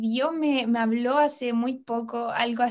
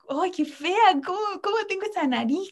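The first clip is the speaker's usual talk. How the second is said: higher mock whine about her own face; mock self-disgust; 6 semitones higher than usual. Woman mocking her unfiltered face in a mild whine. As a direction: sneer at her own reflection, lightly mocking. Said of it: "Decía como".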